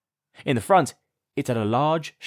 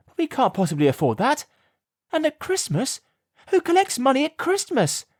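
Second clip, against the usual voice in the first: much higher, disbelieving outburst; a mother stunned by the demand; shocked mum. A narrator's reading. Mum reads the huge fee, shocked disbelief. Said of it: "asked Mum".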